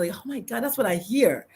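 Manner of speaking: usual speaking voice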